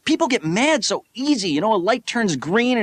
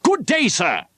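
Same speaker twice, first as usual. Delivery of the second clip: put-on polite old-fashioned farewell; 2 semitones higher than usual. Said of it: "the worst thing you could say".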